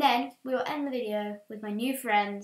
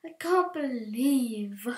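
ordinary talk

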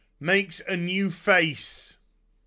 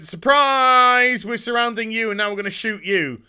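mock gleeful surprise; 4 semitones higher, 5 dB louder than usual. Crows ambush, acted quote.

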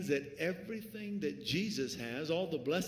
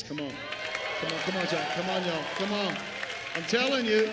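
louder, urging the crowd; louder call for a shout of praise; rousing call; 3 semitones higher, 7 dB louder than usual. Real, not acted. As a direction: whip up the praise.

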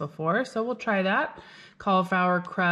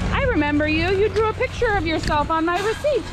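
high playful voice; 11 semitones higher, 3 dB louder than usual. Pretend. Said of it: "So next time you say".

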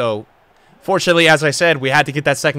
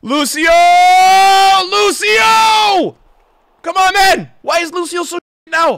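much higher and louder yelling of the name; frustrated shout; 12 semitones higher, 7 dB louder, slower than usual. Real, not acted. Gamer yelling at his defender Lucio, frustrated shout. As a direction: bawl out the player who blundered. Player's mistake.

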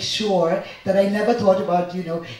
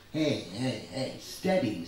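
lower, softer, soothing; a smiling elder calming a child; calming with a smile; 9 semitones lower, 6 dB quieter, slower than usual. Acted. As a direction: settle the excited boy gently, smiling. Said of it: "said Hanuman with a smile".

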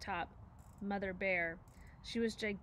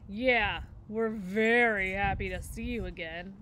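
louder, dopey copycat echo of his sister; little brother bear repeating his sister, louder; 6 dB louder than usual. A narrator's reading. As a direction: parrot her line like a tagalong kid brother. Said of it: "echoed the brother bear".

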